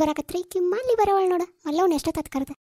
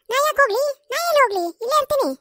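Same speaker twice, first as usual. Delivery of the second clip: high-pitched indignant protest; defiant refusal; 8 semitones higher, faster than usual. Acted. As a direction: refuse stubbornly in a raised pitch. Old woman refuses to go. (ಹಠದಿಂದ ಏರುದನಿಯಲ್ಲಿ ನಿರಾಕರಿಸುವುದು)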